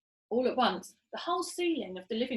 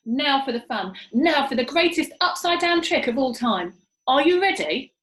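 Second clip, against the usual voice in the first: excited announcement; 7 dB louder than usual. A narrator's reading. Monkey father rallying the animals, loud and excited. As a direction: build up the big trick. Muggle Rump announcing the trick.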